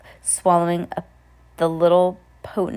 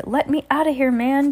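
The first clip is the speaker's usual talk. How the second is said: high, whiny plea; pleading; 8 semitones higher, 4 dB louder than usual. A narrator's reading. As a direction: beg to get out. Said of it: "Jay pleaded".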